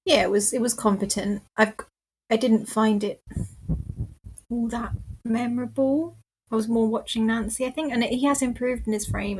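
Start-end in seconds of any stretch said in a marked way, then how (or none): none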